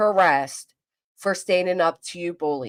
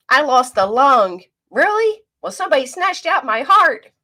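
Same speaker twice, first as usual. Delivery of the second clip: high, loud, overdone one-upmanship; mock victim one-upping; 6 semitones higher, 6 dB louder than usual. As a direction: ham up the victim contest. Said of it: "victimhood contest".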